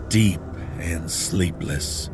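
ordinary delivery, nothing marked

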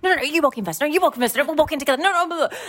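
high, loud, bickering back and forth; mock squabble. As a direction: replay the squabble over who goes first. Acted squabble at the door.

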